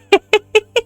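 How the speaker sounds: high, helpless burst of laughter. Real, not acted. Player laughing at her sim's grim death, helpless laugh. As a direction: laugh because there's nothing else to do. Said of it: "I'm not laughing because I think it's funny".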